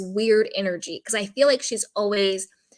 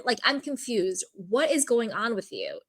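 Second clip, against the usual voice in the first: usual speaking voice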